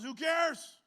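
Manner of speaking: high, loud mock grumble; hammed-up cranky father; gruff old man. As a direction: play the cranky old dad shrugging it off. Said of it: "He goes".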